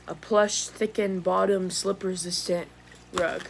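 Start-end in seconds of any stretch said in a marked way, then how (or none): none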